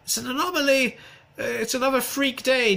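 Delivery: very high, put-on forecaster voice; squeaked-up parody of a TV script reader; mock weather presenter. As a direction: mimic the weather girl way up in pitch. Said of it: "she said".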